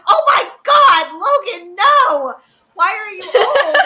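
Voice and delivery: high, loud, laughing dismay; shocked outburst. Retold or imitated. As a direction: wail it in mock horror at him growing up. Retold shock at grown brother.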